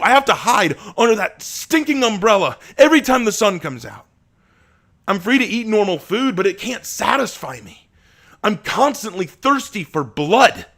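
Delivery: anguished shouting lament. Acted. Asher lamenting his thirst to Abraham, anguished shouting. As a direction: cry out your torment.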